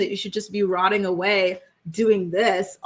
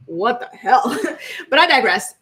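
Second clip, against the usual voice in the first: laughing; 3 semitones higher, 5 dB louder than usual. Real, not acted. Laughing exasperated aside to ladies.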